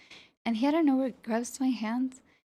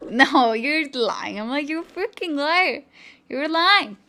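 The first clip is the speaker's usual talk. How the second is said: mock disbelieving yell; 4 semitones higher, 8 dB louder than usual. Retelling disbelief at boyfriend outside.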